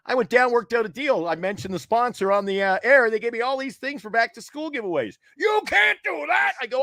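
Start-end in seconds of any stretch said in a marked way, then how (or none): 5.4-6.5 high, drawn-out mock outrage; outraged bosses. Bosses objecting to his own giveaways, outraged. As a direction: imitate a scandalised boss, slow and squeaked up high.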